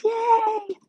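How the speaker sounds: very high squeal of yay; delighted cheer that the sound works; excited cheer. Real, not acted. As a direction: cheer happily, pitch way up. Sound confirmed great.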